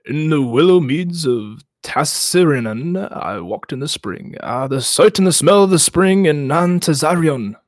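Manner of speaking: slow, drawn-out ancient tree chant; slow solemn Ent chant, savoring each place name; Treebeard voice. Acted. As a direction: intone it slowly like an old Ent remembering. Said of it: "You've got a good Treebeard voice".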